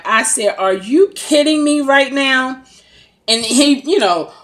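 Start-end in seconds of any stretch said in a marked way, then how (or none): 0.6-2.6 amused disbelief. Retold or imitated. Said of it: "I bust out into this biggest laugh".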